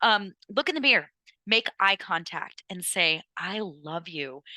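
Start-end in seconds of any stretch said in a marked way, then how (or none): 3.4-4.4 soft, slow and sincere; sincere I love you. Shown as a demonstration. To herself in the mirror, softly and sincerely. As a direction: say it to yourself and mean it. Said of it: "Try to actually mean it".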